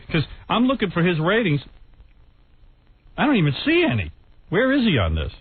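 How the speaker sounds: ordinary talk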